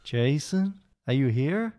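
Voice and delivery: lightly done slow Southern Texan drawl; a Southern Texan drawl, slow and dreamy; imitating dad's Texan drawl. Son imitating his father's Southern Texan drawl. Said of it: "let's see if I can try and channel my father".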